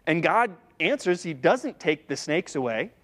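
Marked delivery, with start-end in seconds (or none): none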